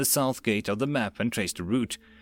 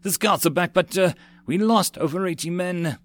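urgent panting report; 6 semitones higher than usual. A narrator's reading. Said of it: "A breathless messenger".

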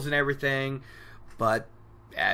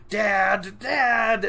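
mock screaming; 5 semitones higher, 3 dB louder than usual. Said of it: "just screaming that they're all dead".

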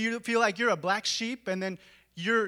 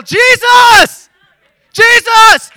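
full-throated shout, much higher and louder; yell; 22 dB louder, much slower than usual. Shown as a demonstration. Preacher showing how to cry out to Jesus. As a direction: call out with all your lungs. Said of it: "You gotta yell".

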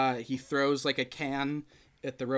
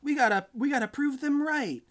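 high falsetto pep talk; imitated earnest high-pitched speech; 11 semitones higher than usual. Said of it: "[name] talks about how he's like".

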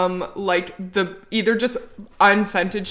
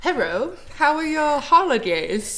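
fake British accent; 6 semitones higher than usual. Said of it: "Can you do an impression of her fake British accent?".

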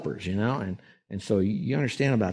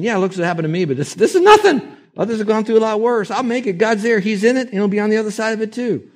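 brave believer; 11 semitones higher, 6 dB louder than usual. Retold or imitated. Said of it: "Instead of saying".

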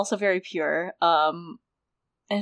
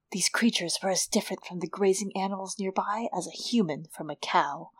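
softer, low aside from the book; hushed, softer book quote; hushed reading; 3 semitones lower, 8 dB quieter than usual. A narrator's reading. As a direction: read it quietly, much softer.

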